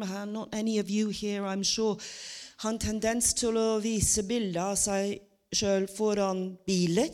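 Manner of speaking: ordinary delivery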